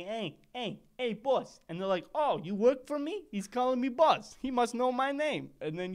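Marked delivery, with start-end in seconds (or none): 0.0-1.6 a hint of a wiseguy greeting the boss; lightly voiced tough-guy hello; wannabe mafia voice. Wannabe mobster showing he got the voice down. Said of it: "I got the voice down". 2.2-5.5 high, loud, pleased mob boss; mock flattered boss. Mob boss flattered by a stranger calling him boss, high and loud.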